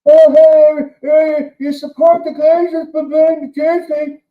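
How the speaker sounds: high, loud whiny mock voice; mocking preachy fan. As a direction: sneer it like a smug scold. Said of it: "gobshites that give out to fans like me".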